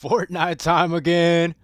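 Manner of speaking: loud, drawn-out, high-pitched hype; hyped announcement. Real, not acted. Gamer opens his Fortnite video for viewers, hyped announcement. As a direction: announce it big and slow.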